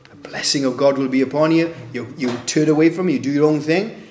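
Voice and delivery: ordinary delivery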